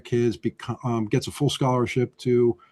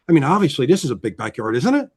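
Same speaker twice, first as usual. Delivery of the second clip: ISN'T IT swung sharply up into a question; raised ending; 3 dB louder than usual. Shown as a demonstration. Agent showing buyer a yard, questioning rise on ISN'T IT. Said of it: "If I raise my voice at the end".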